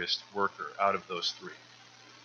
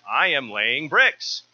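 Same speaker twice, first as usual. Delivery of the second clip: louder, slow and deliberate sarcasm; sarcastic, slow; 4 semitones higher, 8 dB louder, much slower than usual. Acted. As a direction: spell it out slowly, as if it were obvious. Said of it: "he replied sarcastically".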